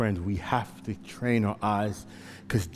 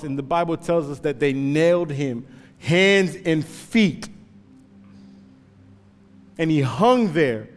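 usual speaking voice

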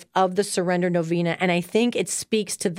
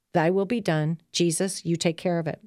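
softer, low surrender prayer; 3 semitones lower, 4 dB quieter than usual. Acted. Host models how listeners should pray, soft and surrendered. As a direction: let go and pray it quietly.

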